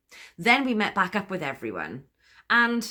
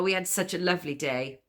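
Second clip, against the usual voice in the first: ordinary delivery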